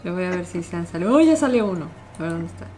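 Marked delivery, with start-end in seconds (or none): 1.2-1.9 loud startled cry; startled shout. Real, not acted. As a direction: jump at the sight. Animatronic appears on camera.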